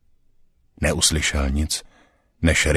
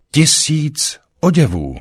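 high, louder, defiant repetition; 11 semitones higher, 5 dB louder than usual. A narrator's reading. Councillor makes a last attempt at resistance, loudly defiant. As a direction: throw it out as a last stand. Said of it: "pokusil se Konšel o odpor".

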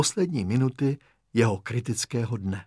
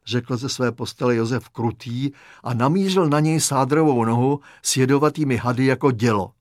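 ordinary delivery, nothing marked

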